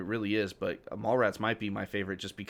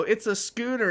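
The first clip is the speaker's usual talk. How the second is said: put-on kids' voice; 9 semitones higher, 3 dB louder than usual. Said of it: "they go".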